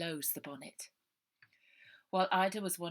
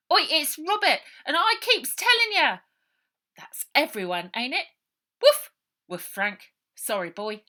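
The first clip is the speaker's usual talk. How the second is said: loud high varied character voices; each character answers in turn; lively shouted roll call of voices; voiced roll call; 9 semitones higher, 13 dB louder than usual. A narrator's reading.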